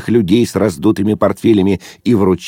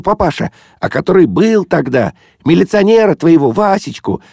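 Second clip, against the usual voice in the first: put-on high, lively young woman's voice; Zosya, excited girl; 10 semitones higher than usual. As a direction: rattle off the happy news, pitched high.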